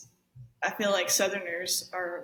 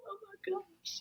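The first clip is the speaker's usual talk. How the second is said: high breathy friends gushing; 13 semitones higher, 7 dB quieter than usual. Retold or imitated. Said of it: "everyone else was like".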